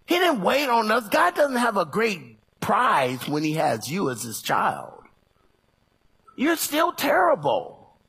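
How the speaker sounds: loud, high preaching shout; full-force preaching. Acted. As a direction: preach it hard to the church.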